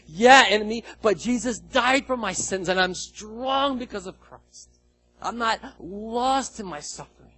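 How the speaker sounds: loud, bold, high declaration against the enemy; defiant declaration. Pretend. Preacher voicing a believer defying the enemy, loud and bold. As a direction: shout it down with faith.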